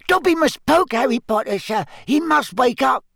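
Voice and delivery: high squeaky elf voice; squeaky house-elf. A narrator's reading. Dobby voice.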